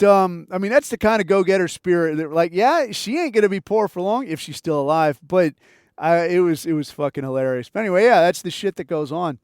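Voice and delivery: ordinary talk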